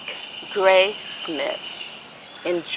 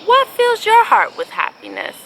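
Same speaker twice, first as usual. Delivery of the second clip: eager question; 13 semitones higher, 6 dB louder than usual. Real, not acted. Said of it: "same question that they asked you".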